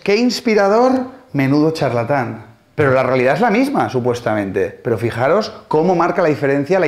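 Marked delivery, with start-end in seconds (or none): 0.0-0.9 high-pitched admiring gush; imitated fan's compliment, pitched up; admiring nice girl. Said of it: "una chica muy maja me dice". 1.3-2.5 imitated dismissive put-down, dropping at the end; understated contempt, falling away; disparaging heckler. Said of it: "también me susurra".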